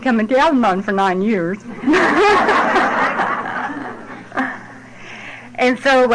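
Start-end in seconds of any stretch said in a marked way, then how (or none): 1.6-4.6 a light rising laugh; bright audience-style laugh. Real, not acted. Laugh after "nine years.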